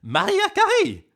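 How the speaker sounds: very high, loud, slow diva belt; diva call. Pretend. Streamer hamming up a pop star name, high loud belt.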